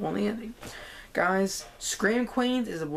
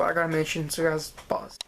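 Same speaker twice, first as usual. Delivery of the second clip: ordinary delivery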